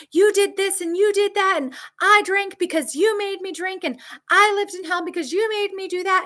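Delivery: high, harsh mock accusation; mock blaming partner. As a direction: throw the blame back at her, high.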